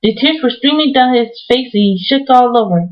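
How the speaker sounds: ordinary delivery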